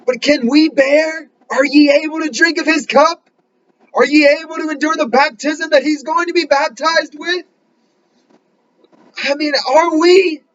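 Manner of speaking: high, urgent preaching appeal; fervent preaching. Acted. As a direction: press the challenge on the flock.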